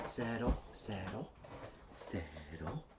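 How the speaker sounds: soft, hushed calming of a pet; barely above a breath, gentle; soothing dog. Real, not acted.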